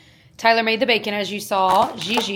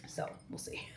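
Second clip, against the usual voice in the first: whisper; 20 dB quieter than usual. Real, not acted. Quiet aside.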